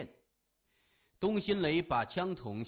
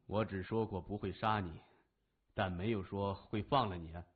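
smug reply; 6 semitones lower than usual. A narrator's reading. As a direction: boss coolly correcting the captive. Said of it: "谢文东笑了".

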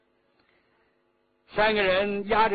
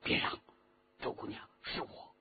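hushed, softer whispery plea; low voice; 8 dB quieter than usual. A narrator's reading. Man stopping the startled girl at night, low voice. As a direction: keep it down, barely voiced at the end. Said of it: "低声说".

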